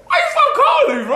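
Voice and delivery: begging a friend to keep calling; high mock plea. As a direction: squeak it out through a laugh. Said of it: "I don't know how to say".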